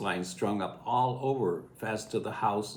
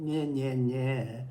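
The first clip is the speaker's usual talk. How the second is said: taunting nyeh nyeh; 5 semitones higher, much slower than usual. Retold or imitated. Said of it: "and I went".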